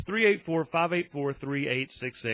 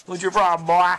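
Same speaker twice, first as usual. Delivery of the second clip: higher mock-scolding to the dog; playful dog talk; 4 semitones higher, 8 dB louder than usual. Real, not acted. Owner calls his dog over, playful mock scolding.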